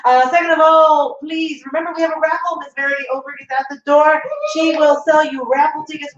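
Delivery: ordinary talk, nothing marked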